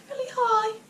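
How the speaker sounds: squeaky falsetto cry; demonstrating own high singing voice; really high voice. Shown as a demonstration. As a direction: shoot up into a silly falsetto. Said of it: "I don't know what voice that was".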